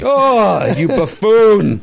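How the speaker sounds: very high, loud, shrill-edged gush; gushing Palin impersonation. Pretend. Mocking Palin answering the fan, high loud gushing. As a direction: gush like a flattered politician. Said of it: "acting Palin's reaction".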